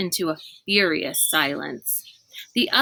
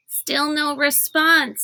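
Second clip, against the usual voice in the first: a lightly voiced boy, slow and high; worried kid at the window, drawn out; Sticky's voice; 7 semitones higher, slower than usual. A narrator's reading. Sticky reports to the others, slow and high.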